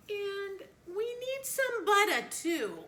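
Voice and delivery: breezy, as if nothing happened; clueless mom. Retold or imitated. Mom casually adding to the shopping list, airy and high. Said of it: "and my mom goes".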